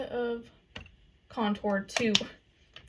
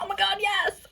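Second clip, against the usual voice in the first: thrilled squeal; 10 semitones higher, 5 dB louder than usual. Retold or imitated. Retells reacting to seller's discount.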